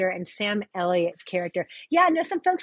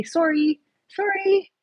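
higher-pitched Canadian "sorry"; Canadian accent; 11 semitones higher, slower than usual. Shown as a demonstration. Asked by viewer for a Canadian accent, Canadian accent. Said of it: "I can just say".

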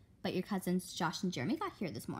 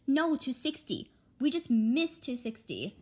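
higher, slow, annoyed correction; exasperated; 6 semitones higher, 5 dB louder than usual. Acted. Mother correcting her daughter on the exit, exasperated. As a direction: stress the number in frustration.

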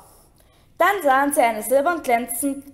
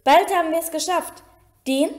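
raised-pitch rallying call; 5 semitones higher, 4 dB louder than usual. A narrator's reading. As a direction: urge the class on. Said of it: "rief Lupin". (anfeuernder, hoher Zuruf)

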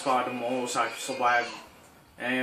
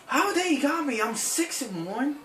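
shocked disbelief; 7 dB louder, slower than usual. Real, not acted. Reacting to dying in game.

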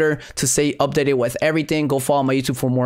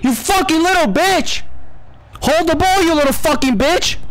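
furious yelling; 12 semitones higher than usual. Real, not acted. Gamer yelling at a ball-holding opponent, furious. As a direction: scream abuse at the ball-hogger.

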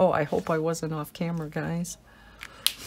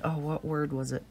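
usual speaking voice

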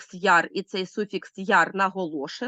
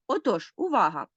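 normal talking voice

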